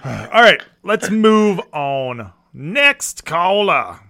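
slow, loud, drawn-out move on; loud host cutoff. Real, not acted. Host shutting down a rambling caller, loud and slow. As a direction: boom it out to end the call.

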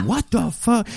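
high, shocked parent voice; mock shocked parents. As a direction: play the horrified parents. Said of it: "for them to be like".

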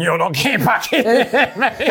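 high, loud, laughing through the words; teasing a mate while cracking up; laughing tease. Retold or imitated.

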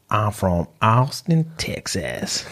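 put-on slow, low Texan accent; Southern American accent. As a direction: draw out a Texas Southern accent. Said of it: "Southern America".

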